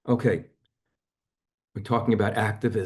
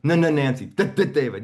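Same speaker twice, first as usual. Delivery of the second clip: playful stutter back and forth; 5 semitones higher, 4 dB louder than usual. Retold or imitated. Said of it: "So I say".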